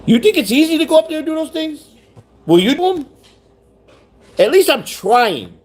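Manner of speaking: defensive rising challenge to the chat; indignant retort. Real, not acted. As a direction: fire it back at the doubter, pitch up high. Defends himself against a heckler.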